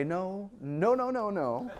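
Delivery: high-pitched mock parental alarm; parents objecting. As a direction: imitate parents frantically protesting in the background. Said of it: "hear the parents in the background say".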